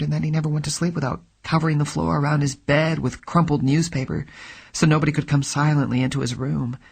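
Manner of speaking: a hint of a nervous woman confiding; Brigid's voice. A narrator's reading. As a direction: narrator voicing the frightened client. Narrator voices Brigid's long speech.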